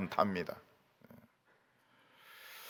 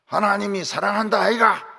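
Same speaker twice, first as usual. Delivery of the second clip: Gyeongsang dialect; 5 semitones higher, 5 dB louder than usual. Shown as a demonstration. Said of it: "경상도 말로".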